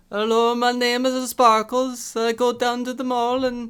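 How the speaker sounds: very high, loud squeaky voice; bad Italian accent. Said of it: "Try it".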